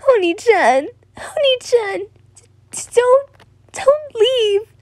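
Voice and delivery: put-on high stammering child's wail; crying out. Boy calls after sister walking away in rain, stammering high cry. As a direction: call after them, sobbing and very high. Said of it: "he cried out".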